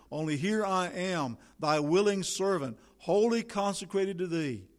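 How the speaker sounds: rising pulpit fervour; preacher pleading to be sent; fervent preaching. Acted. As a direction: build it like a battle call. Fervent soldier-servant prayer.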